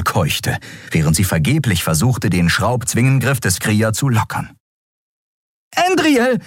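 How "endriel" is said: high-pitched alarmed cry; small creature's high call. A narrator's reading. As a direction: cry out the name to a friend in danger. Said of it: "rief Nelen".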